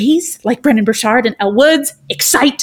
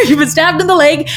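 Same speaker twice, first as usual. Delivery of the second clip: laughing into the words, a little higher; amused laugh; 5 semitones higher than usual. Real, not acted. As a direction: chuckle as you repeat it. Laughs at own odd example.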